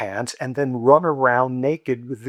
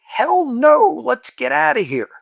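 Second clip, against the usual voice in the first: high, loud alarmed "hell no"; shouted refusal; 12 semitones higher, 8 dB louder than usual. Retold or imitated. Friends urging the teller to flee, loud alarmed shout. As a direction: yell it, eager to flee.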